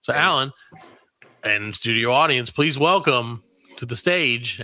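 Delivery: ordinary delivery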